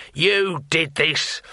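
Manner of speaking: restrained scream, stretching each word; screamed accusation. Acted. Thief blaming the wizard for the fire, screaming. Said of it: "he screamed".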